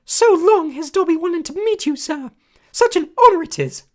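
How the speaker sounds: high, loud squeaky elf voice; a small eager servant creature, awed; squeaky house elf. A narrator's reading. House elf greeting Harry, high squeaky and awed. Dobby voice, held.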